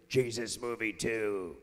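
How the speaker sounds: deep, slow trailer voice; as the sequel promo, low and slow; movie-trailer announcer. Acted.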